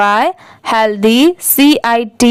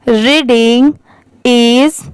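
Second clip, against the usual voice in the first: slow, word-by-word model reading; repeat-after-me reading; much slower than usual. Shown as a demonstration. Teacher reads for learners to repeat after, slow word-by-word reading. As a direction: read each word separately, leaving gaps to repeat. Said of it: "आप भी मेरे बाद repeat करिए".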